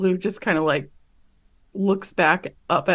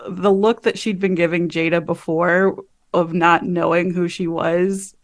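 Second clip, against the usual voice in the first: ordinary talk, nothing marked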